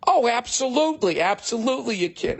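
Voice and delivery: high-pitched, emphatic clerk's yes; hammed-up eager yes, pitched high; gushing agreement. Clerk's absurd sudden agreement.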